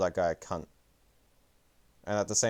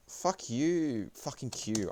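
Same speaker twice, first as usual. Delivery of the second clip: exasperated cursing; 6 semitones higher, much slower than usual. Real, not acted. Cursing at stream trouble.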